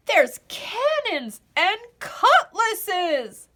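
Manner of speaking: high, loud, very slow thrilled cry; excited cry. A narrator's reading. Dots discovering the weapons, loud high excited cry. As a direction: cry out in delight. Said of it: "cried Dots".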